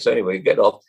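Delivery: ordinary talk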